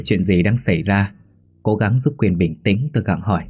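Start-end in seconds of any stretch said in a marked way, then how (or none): none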